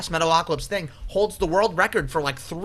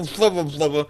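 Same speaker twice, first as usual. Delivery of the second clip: ordinary talk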